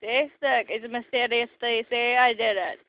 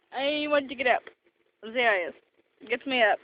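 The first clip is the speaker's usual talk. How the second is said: ordinary talk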